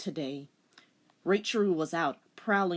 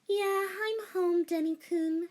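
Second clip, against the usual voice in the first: sighing; 13 semitones higher than usual. A narrator's reading. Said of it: "she sighed".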